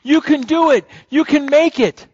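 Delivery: a lightly voiced cloud of witnesses calling encouragement; mild cheering crowd. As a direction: cheer him on like the crowd in the stands. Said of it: "Can you hear 'em saying".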